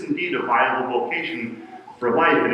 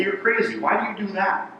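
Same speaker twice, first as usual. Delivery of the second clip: higher, incredulous quoted friends; mock disbelief; 7 semitones higher, 3 dB quieter than usual. Friends questioning churchgoing parents, mild mock disbelief.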